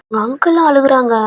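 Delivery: usual speaking voice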